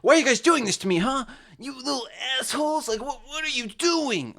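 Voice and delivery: high, exasperated mock rant at critics; mock angry rant. Shown as a demonstration. YouTuber mocking how she would rant: high, whiny exasperation. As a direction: whine it out like an indignant, cornered singer. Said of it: "harder to talk like".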